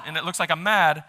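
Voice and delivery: ordinary talk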